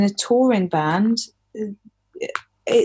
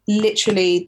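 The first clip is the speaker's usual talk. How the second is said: usual speaking voice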